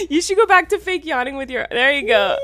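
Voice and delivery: ordinary talk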